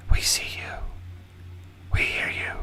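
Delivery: ghostly whispered voices; creepy unvoiced whisper from the woods; eerie whisper. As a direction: breathe it like a voice in the trees. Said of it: "it's people whispering".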